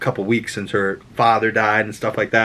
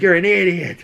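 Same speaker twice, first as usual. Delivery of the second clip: scolding statesman; 6 semitones higher than usual. Retold or imitated. Otto insults Aegon.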